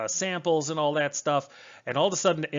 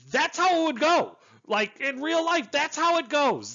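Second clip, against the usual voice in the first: emphatic approval; 13 semitones higher, 4 dB louder than usual. Real, not acted. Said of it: "excited praise".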